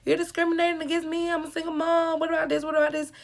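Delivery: high-pitched mock complaint, quick at first; mocking the mom. Mimicking the single mom protesting to the stylist, high mock whine. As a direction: play the entitled mom whining back. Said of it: "she couldn't just be like".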